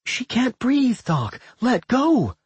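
high-pitched frantic plea; panicked cry. A narrator's reading. Rowan pleads for a girl who can't breathe, panicked. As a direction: cry out in alarm. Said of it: "Rowan cried out in panic".

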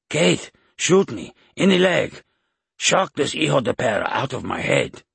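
put-on loud, high Mexican-accented outburst. A narrator's reading. Possessed man breaking through to beg Kate, shouted with Mexican accent. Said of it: "He heard the man's Mexican accent".

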